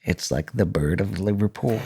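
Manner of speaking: ordinary talk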